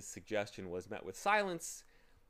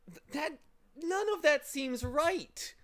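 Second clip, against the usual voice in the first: put-on a higher, slow, helpless boy; 7 dB louder than usual. Boy confused by the bird, helpless and slow. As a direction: give up in confusion. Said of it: "said Milo helplessly".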